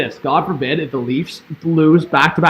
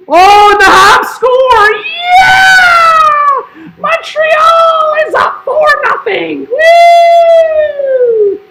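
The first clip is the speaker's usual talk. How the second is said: very high, loud drawn-out screaming cheer; hammed-up falsetto goal call; over-the-top goal celebration; 8 dB louder, much slower than usual. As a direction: shriek it out like an ecstatic fan. Celebrating a goal in mock joy.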